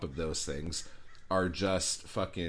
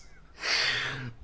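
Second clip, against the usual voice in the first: incredulous laugh; 5 semitones higher than usual. Real, not acted. Reading the author's website.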